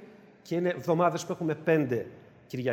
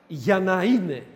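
usual speaking voice

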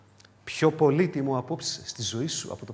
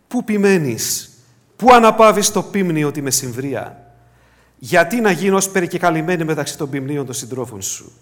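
scripture reading; 2 semitones higher, 10 dB louder than usual. Acted. Reads Song of Songs aloud.

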